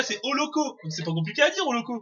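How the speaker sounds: ordinary delivery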